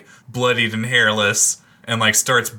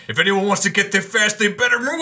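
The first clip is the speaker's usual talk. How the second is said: fast, high, rising voice; transforming into horse; 10 semitones higher, faster than usual. Acted. Garrett warns riders while becoming a horse, high and rushed. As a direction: climb in pitch as the horse takes over. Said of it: "As he's transforming, he's like".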